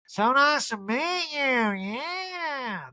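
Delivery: high, slow gushing impersonation; Erica. Retold or imitated. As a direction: stretch it into a long high coo. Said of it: "And Erica's like".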